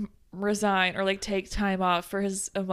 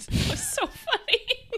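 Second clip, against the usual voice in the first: high, slow laughing voice. Real, not acted. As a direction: laugh through the words. Laughing after joke.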